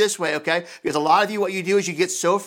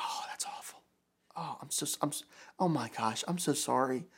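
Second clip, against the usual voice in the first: whispered, much softer comfort; sympathetic whisper; 5 semitones lower, 13 dB quieter than usual. Retold or imitated. Pastor voices a husband soothing his wife in a whisper. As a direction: soothe her in a hushed voice. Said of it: "just to listen and be like".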